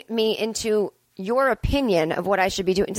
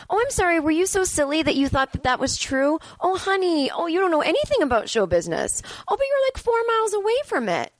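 much higher, sugary mock-sweet put-down; condescending; 10 semitones higher than usual. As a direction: patronize them like a know-it-all. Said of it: "the condescending one".

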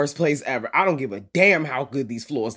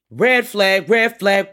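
loud, higher repeated alert; alarm warning; 5 semitones higher, 8 dB louder, slower than usual. Acted. Friend warning Morgan about the earring piece, loud alarm. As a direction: call it out like a siren warning.

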